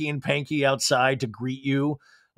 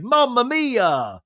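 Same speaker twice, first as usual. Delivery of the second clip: higher, very slow mock Italian exclamation; mock chef; 7 semitones higher, much slower than usual. James admiring his pasta with a slow hammed-up mamma mia. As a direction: savour it like a proud cook. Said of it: "he's like".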